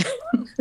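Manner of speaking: short high rising giggle; a quick high laugh at her own joke. Real, not acted. Guest laughing at the end of her answer, high giggle.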